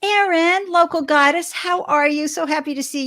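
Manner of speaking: light up calling out to a friend; excited greeting. Real, not acted. Host spotting a local friend in the chat, in an excited high greeting.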